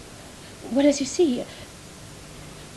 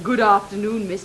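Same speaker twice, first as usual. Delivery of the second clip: lower, mock stiff greeting; the stepdaughter mimics the actor to mock him, lower, stiff; mocking the actor's greeting; 7 semitones lower than usual. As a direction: parody his wooden hello. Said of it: "in that tone of voice".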